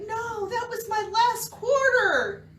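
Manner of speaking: high, drawn-out mock wail; dismayed little brother. Young brother pleading at a payphone, mock dismay. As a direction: imitate a kid's slow, loud lament.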